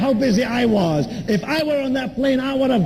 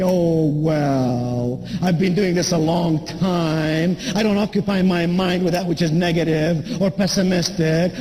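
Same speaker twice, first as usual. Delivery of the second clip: lower, unhurried voice of calm wisdom; serene flight attendant; 6 semitones lower than usual. Retold or imitated. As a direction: answer low and at peace, in no rush. Imagined calm flight attendant replies.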